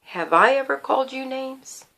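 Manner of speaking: soft, measured question; real quiet. Retold or imitated. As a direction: ask it quietly, without any attack. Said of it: "I got real quiet".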